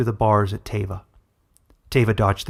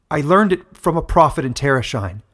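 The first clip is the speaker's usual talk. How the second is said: defiant; 4 semitones higher, 7 dB louder than usual. Acted. Daughter answers mother defiantly.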